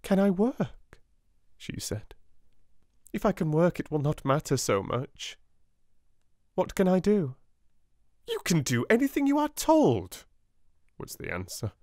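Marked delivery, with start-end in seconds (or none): 0.0-7.4 put-on higher, hopeful child's question; light up with relief; eager young girl asking for work; relieved little girl. A narrator's reading. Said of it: "a faint gleam of light ... a shade of relief". 8.3-10.2 much higher, curt reply; sharp stern schoolmistress; cold headmistress. A narrator's reading. Miss Minchin's cold answer.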